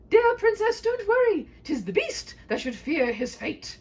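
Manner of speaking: high, loud ringing declaration; heroic vow. Acted. Knight vowing to slay the dragon, high and loud. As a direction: proclaim it like a hero.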